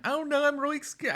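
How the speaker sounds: high-pitched, sarcastic fake panic; mock fear. Host mocking her critic's threats, mock fear.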